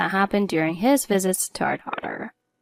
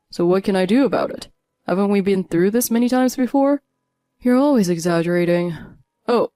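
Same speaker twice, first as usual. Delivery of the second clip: a hint of a cold, dismissive husband, louder; 4 dB louder than usual. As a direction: brush her off, lightly voiced.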